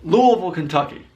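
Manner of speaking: "Louisville" slurred to LOO-uh-vul; local pronunciation. Shown as a demonstration. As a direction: run "Louisville" together with a swallowed ending. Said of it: "is the right way you say it".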